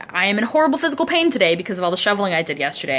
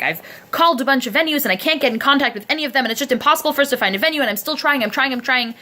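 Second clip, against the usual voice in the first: higher, fast exasperated whine; hammed-up harried co-producer, rushed and higher; mock whiny co-producer; 6 semitones higher, faster than usual. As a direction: rattle off excuses, harried. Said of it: "co-producer was like".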